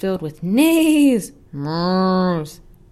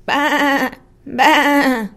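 higher louder bleating baa; goat bleat; 8 semitones higher, 8 dB louder than usual. Acted. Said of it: "All she heard was".

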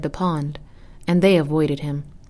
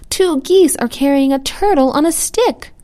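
high, loud astonished announcement; excited child's cry; 9 semitones higher, 6 dB louder than usual. A narrator's reading. Child tells others about the flying turtle, excited cry. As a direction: shout the news high, in a child's wonder. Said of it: "cried one child".

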